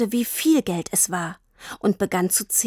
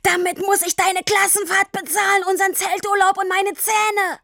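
high, furious yell; 11 semitones higher than usual. A narrator's reading. Papa rages at Tini about the money, yelling. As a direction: yell it across the whole street. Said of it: "Er schrie über die ganze Straße".

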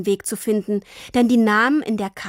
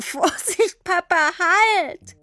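much higher, slow squealed call; squealing; 9 semitones higher, much slower than usual. A narrator's reading. Girl pulled along by her father, squealing. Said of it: "Sie quietschte und lachte".

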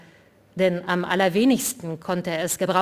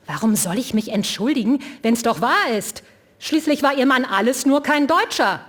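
louder, rising into an angry shout; in a rage; 6 semitones higher, 5 dB louder than usual. A narrator's reading. Vinzenz shouts back at the widow in rage. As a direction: hurl it back furiously. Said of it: "rief Vinzenz schon wieder in Rage".

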